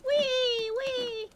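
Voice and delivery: happy wee; gleeful wee. Real, not acted. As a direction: squeal with joy. Riding playful.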